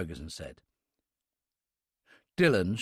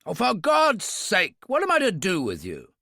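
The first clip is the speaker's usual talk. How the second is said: demanding; 6 semitones higher, 4 dB louder than usual. A narrator's reading. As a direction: throw up your hands and demand it. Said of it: "Ferguson demanded".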